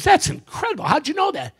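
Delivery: high-pitched acted amazement; amazed old man. Gentleman amazed by woman's guess, high astonished question. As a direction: marvel at it, voice leaping up. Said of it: "The guy goes".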